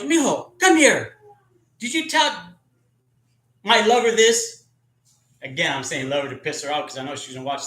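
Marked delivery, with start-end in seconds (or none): 0.0-4.6 high and loud, calling him over then accusing; sister's accusing voice. Retold or imitated. Older sister calls her little brother over, high and loud accusation.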